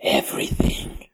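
a soft whispered punchline. Real, not acted. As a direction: breathe the word out as a smug secret.

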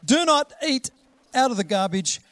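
loud, high, firm parental order; stern parent calling out a command; scolding parent. Acted. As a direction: tell off a small child, loudly and emphatically. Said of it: "We say".

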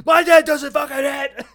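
high, loud indignant yell, hammed up; mock angry shout. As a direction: shout it back like an offended gamer. Defensive gamer's outburst.

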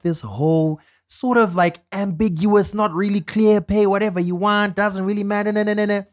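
high, mocking sing-song. Mocking the old pay system.

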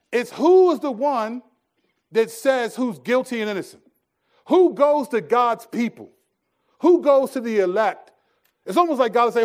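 full-force preaching. Acted. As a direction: build it like a pulpit climax, each who hammered.

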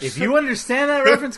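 words squeezed out through a laugh, high and loud. Real, not acted. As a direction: talk while still laughing.

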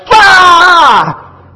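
high, loud frightened "Ah!"; startled scream. Retold or imitated. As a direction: shriek like you've been ambushed. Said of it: "And I went".

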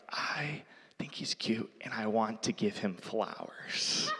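put-on breathy voiceless whisper, a little girl's secret; hushed whisper of a small girl with a crush; imitated child whisper. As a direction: whisper it like a shy child, ending in a giggle. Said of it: "she was whispering".

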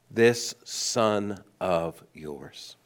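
"This son of yours" drawn out very slowly, "yours" pushed away; cold distancing stress. Retold or imitated. Elder son disowns his brother to the father, pointed and slow. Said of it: "watch the language".

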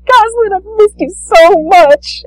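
high, slow, gushing with love. Retold or imitated. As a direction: squeal it like a doting dad. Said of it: "He's just like".

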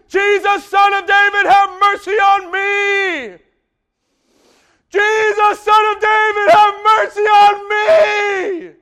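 high, loud, slow desperate cry; shouting beggar. Acted. Blind Bartimaeus shouting to Jesus, desperate and loud. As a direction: cry out like a blind man begging. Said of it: "he starts shouting".

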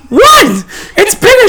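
baffled that bigger is worse; incredulous. Retold or imitated. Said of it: "I'm like".

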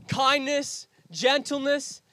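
normal talking voice

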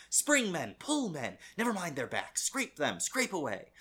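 acted-out intense mate driving his rowers; intense urging. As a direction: urge the crew on in intense, punchy bursts. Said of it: "like, really intensely whispering".

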